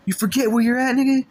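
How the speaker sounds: loud, high taunting shout; mock him for forgetting where he is; scornful yell. OG mocking question, loud.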